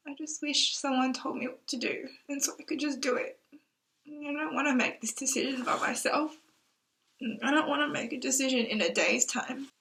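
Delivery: holding back real tears; teary. Real, not acted. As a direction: voice wobbling on the edge of tears. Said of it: "Oh, why am I crying?".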